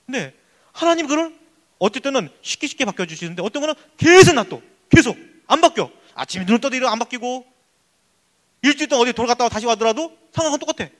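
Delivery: ordinary talk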